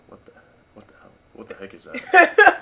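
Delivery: mock bewildered what the heck; baffled outburst. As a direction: act stunned at a strange phrase. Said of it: "I'm just like".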